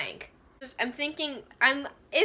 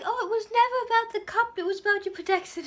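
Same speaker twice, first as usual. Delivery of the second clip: high, breathy-free hammed-up swoon; lightly voiced lovesick Jace; mock romantic hero; 8 semitones higher, faster than usual. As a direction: send up his cheesy line. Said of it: "Jake's like".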